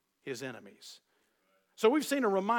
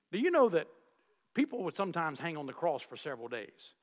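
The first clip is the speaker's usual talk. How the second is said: ordinary talk, nothing marked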